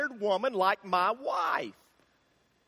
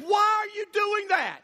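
shouted question; 11 semitones higher than usual. Retold or imitated. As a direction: shout it across the parking lot. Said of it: "She said".

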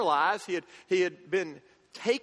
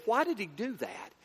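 ordinary delivery

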